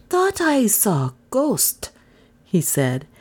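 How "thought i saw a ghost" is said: high, slow apologetic butler voice. A narrator's reading. Butler excuses himself as he backs out, apologetic. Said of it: "smiling apologetically".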